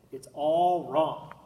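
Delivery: plain reading voice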